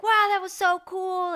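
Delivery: high, loud, drawn-out "wow, so cool"; impressed fans. Retold or imitated. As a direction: gush like an amazed partygoer. Crowd praising after cake.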